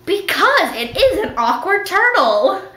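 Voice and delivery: slow, high insistence on "is an awkward turtle"; emphatic insistence. Pretend. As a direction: stretch "is" and "awkward" with swooping pitch. Insisting in answer to imagined doubters.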